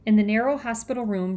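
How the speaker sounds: usual speaking voice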